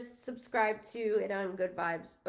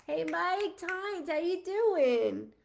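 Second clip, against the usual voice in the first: cheery greeting; 7 semitones higher, much slower than usual. Real, not acted. Bright greeting to live viewers.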